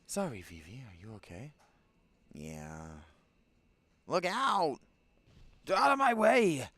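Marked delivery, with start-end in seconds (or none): 4.1-6.7 urgent yelled warning, high and loud; shouting. Acted. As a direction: yell it out in alarm. Battle, character shouting warning.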